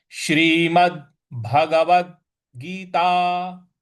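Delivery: Shrimad hit firmly, then Bhagavad Gita slowly; stress on Shrimad. Shown as a demonstration. Teacher showing students the stressed title, slow. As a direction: press the first word Shrimad, recite slowly. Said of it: "तो इस प्रकार बनेगा".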